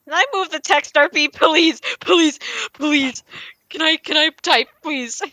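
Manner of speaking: loud, high begging; pleading. Real, not acted. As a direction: beg over and over. Player begging to type instead.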